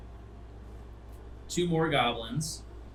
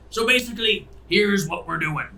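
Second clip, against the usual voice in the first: higher, louder captain's briefing; ship captain; 7 semitones higher, 5 dB louder than usual. Acted. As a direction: address the crowd as their captain. Captain begins briefing the crowd.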